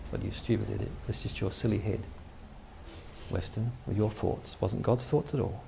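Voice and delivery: soft, low scolding of himself; muttered self-scolding. Retold or imitated. Weston scolding himself at the empty door, soft and low. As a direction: grumble at yourself under your breath.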